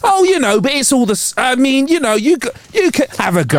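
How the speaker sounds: mock a fussy casting director; posh casting director. Mimics casting director's excuses.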